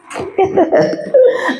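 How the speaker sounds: real laugh. Real, not acted. Laughing at kitten answer.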